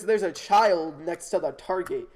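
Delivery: ordinary delivery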